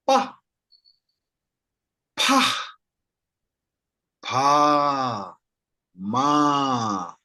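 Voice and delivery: Pa Pa Pa then Ma, long and drawn out; slow drill of Pa then Ma; syllable drill. Shown as a demonstration. Teacher to class, drilling each sound slowly. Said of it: "I will read them one by one".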